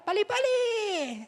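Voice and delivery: high, drawn-out Korean "Pali-pali!"; a playful Korean hurry-up shout; mock Korean hurry call. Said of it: "Parang sa Korea".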